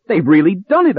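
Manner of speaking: shocked exclamation of disbelief; horrified cry. A narrator's reading. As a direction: cry out in horror. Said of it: "I cried".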